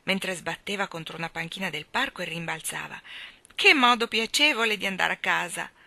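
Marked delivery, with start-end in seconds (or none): 3.5-5.7 entusiasta. A narrator's reading. Bambini volano felici.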